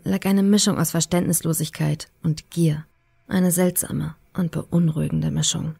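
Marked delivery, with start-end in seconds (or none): none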